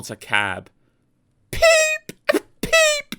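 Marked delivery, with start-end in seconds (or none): 1.5-3.2 very high spluttered peep; spluttering whistle. A narrator's reading. Soaked Peter Sam spluttering his whistle for help. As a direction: whistle through water. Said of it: "he spluttered".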